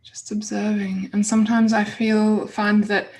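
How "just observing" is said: ordinary talk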